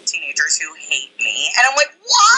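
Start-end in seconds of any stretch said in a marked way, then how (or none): 2.1-2.4 high, loud retold "why"; hammed-up shout of bewilderment; mock anguished cry. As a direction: wail it in exaggerated disbelief. Mock-anguished reaction to hateful teenagers.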